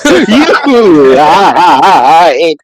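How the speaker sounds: a hint of a wailing child, laughing through it; imitating a sobbing kid, lightly; mock crying child. Dad mimics his son caught finding out about Santa, mock crying. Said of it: "the way he cried".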